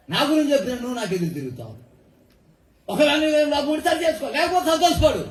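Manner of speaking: high, ringing preacher's cry; fervent sermon rebuke, pitched high; preaching at full force. Acted. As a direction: drive the rebuke home from the pulpit.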